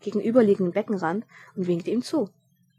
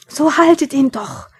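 slow, loud high yell; shouting; 6 semitones higher, 11 dB louder than usual. Acted. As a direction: bellow it out, drawn out. Shouting again across pool.

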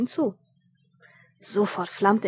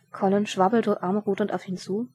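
usual speaking voice

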